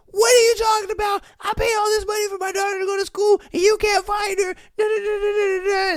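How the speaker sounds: high-pitched, rapid irate rant; mock yelling. Imitating an irate mother calling her daughter's university, yelling. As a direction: rattle it off high, dissolving into da-da-da. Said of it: "you're over here yelling".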